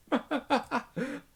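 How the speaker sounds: high, rising unhinged laugh. Acted. The narrator laughs out as he decides to kill, unhinged. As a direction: burst into a crazed laugh. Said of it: "I laugh out".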